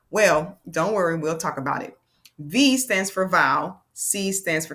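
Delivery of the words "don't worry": ordinary talk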